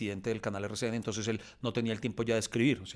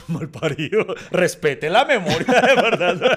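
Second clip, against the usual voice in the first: much louder, higher, slow, laughing outrage; laughing mock scold; 7 semitones higher, 13 dB louder, slower than usual. Real, not acted. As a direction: scold your friend while cracking up.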